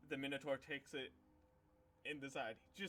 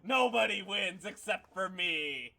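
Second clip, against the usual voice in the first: much higher, loud showman crowing; gloating announcer; 7 semitones higher, 14 dB louder than usual. Acted. Karn the arena host declaring himself winner, loud gloating. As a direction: crow over the draw to the crowd.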